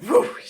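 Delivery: a loud, high whoosh sound effect; imitated whoosh. Describing the baby flying out, mimics whoosh. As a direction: sweep the word out like a rush of air. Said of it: "my whole body was like".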